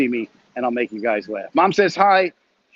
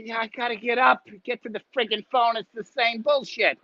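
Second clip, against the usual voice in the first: put-on higher, grumbling old mother voice; mother's grumble; 9 semitones higher than usual. Mother complaining about getting to the phone, higher voice. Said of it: "mother's reply retold".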